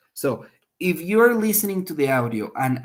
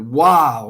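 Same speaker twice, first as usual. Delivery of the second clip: loud, stretched WOW; emphatic tone; 10 dB louder than usual. Shown as a demonstration. Teacher giving an example of tone of voice, emphatic WOW. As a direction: punch WOW loudly. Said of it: "the tone of voice".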